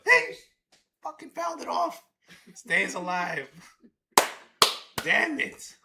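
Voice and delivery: high, loud groan at the foul; real frustrated outburst; frustrated yell. Real, not acted. Foul ball ruins the moment.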